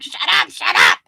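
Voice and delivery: shouting him down, "shut up, shut up"; retold yell. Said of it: "and I'm like".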